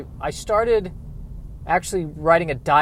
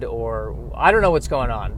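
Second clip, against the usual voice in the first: ordinary talk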